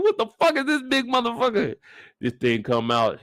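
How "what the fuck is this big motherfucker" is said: mock shocked shout.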